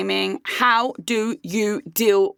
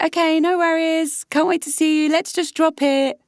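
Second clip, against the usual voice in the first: higher, breezy mock-cheerful switch; sudden fake sweetness; 5 semitones higher than usual. Imitating the ex's instant switch, sugary and breezy. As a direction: flip into a sugary all-is-well voice. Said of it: "it was an immediate switch".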